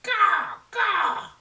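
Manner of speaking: high, loud crow call; raven caw. Acted. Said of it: "became Raven once again and said".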